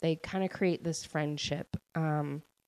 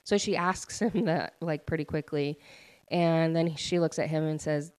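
ordinary delivery

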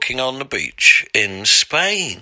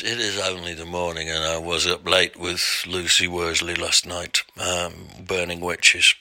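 nodding off; 5 semitones lower, 5 dB quieter than usual. Real, not acted. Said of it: "as you can hear".

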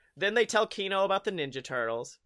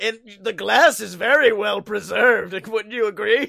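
mock Splinter; 3 semitones higher, 8 dB louder than usual. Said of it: "he's just like".

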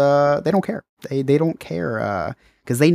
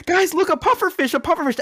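much higher, chirpy imitation of the snorkel guide; guide's excitement. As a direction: mimic the guide cheerfully pointing at a fish. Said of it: "The guy's like".

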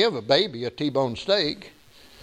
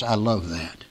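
ordinary talk, nothing marked

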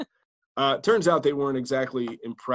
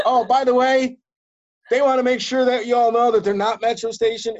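loud, high mock announcer to a crowd; retold crowd announcement, high and loud; stage announcement; 10 semitones higher, 8 dB louder than usual. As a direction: project it like a mic announcement.

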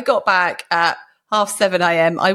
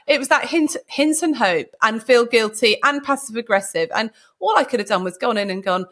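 ordinary talk

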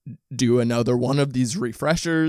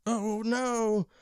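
high, soft, drawn-out dismay; quiet high-pitched lament; 9 semitones higher, 7 dB quieter than usual. Real, not acted. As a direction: let out a small, high lament. Just dropped their joint.

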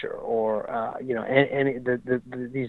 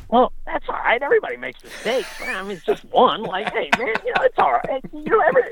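high, lightly mocking trainer voice; retelling how the trainer consoled him: high mock voice; imitating Frankel; 13 semitones higher than usual. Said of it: "he was like".

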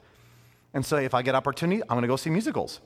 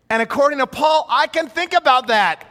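higher, louder declaration; triumphant emphasis; 9 semitones higher, 8 dB louder than usual. Real, not acted. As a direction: land the point with glee. Triumphant point in sermon.

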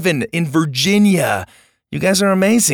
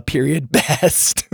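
laughing read; 2 semitones higher, much slower than usual. Real, not acted. Reading the review's punctuation aloud.

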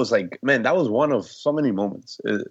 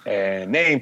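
ordinary talk, nothing marked

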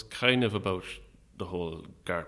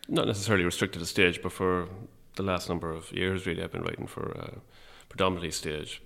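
normal talking voice

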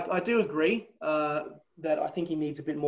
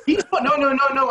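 louder, higher no through laughter; laughing protest; 8 semitones higher, 7 dB louder than usual. Real, not acted. Guest laughing off the host's Pocock question, loud laughing protest.

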